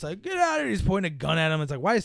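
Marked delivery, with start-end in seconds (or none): 0.2-0.7 high-pitched mock order; acted guard getting in their face; confrontational guard. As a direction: order them out in a raised, hammy voice. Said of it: "starts getting in their face".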